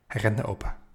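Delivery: ordinary delivery, nothing marked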